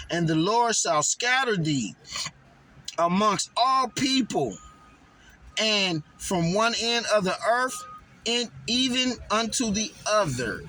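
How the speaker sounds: measured, solemn Bible reading; scripture reading. Acted. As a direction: read it like verses from the pulpit. Reads scripture aloud.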